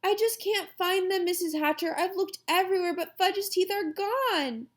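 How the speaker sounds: put-on high, whiny, upset little girl; sniffling. A narrator's reading. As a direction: report it helplessly through mild sniffles. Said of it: "Sheila sniffled and said".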